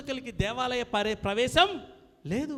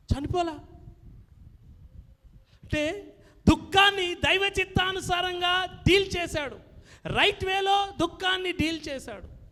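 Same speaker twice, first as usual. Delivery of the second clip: louder, higher sermon voice; full-force preaching; 4 semitones higher, 4 dB louder than usual. Acted. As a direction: drive the names home like a preacher. Preacher describes Job's suffering. (బిగ్గరగా బోధ)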